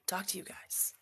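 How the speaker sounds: hushed rushed whisper. Retold or imitated. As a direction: whisper it quickly under your breath. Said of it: "so I was like".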